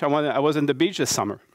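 normal talking voice

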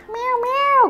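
high kitten meow with a laugh in it. Pretend. Voicing the Valentine kitty, high meow. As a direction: mew like a playful kitten.

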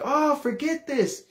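high, drawn-out exasperation; exasperated outburst. Retold or imitated. Teenage son walking out on mom, exasperated. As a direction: give up in a high-pitched huff. Son storming out of retold quarrel.

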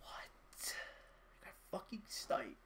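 very soft, voiceless disbelief; stunned whisper. Real, not acted. Gamer to himself after getting sniped, stunned whisper. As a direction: whisper it in shock.

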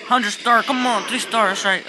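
loud, high shout of triumph; excited cheering. Real, not acted. Gamer urges on a three-star attack, excited shouting.

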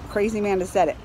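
usual speaking voice

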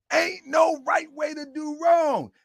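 high-pitched, emphatic proclamation; preaching cry. Real, not acted. Repeats the saying as preaching.

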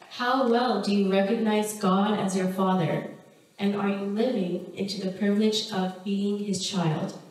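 normal talking voice

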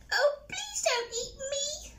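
high, squeaky little spider voice; pleading spider. Acted. Spider begs the hungry troll, high squeaky plea. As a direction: beg for your life in a tiny squeak.

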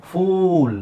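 ಫೂಲ್ with a long, stretched oo; drawn-out oo. Shown as a demonstration. Teacher showing where to stretch the sound, long oo. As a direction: drag out the vowel of ಫೂಲ್. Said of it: "ಎಳಿಬೇಕು".

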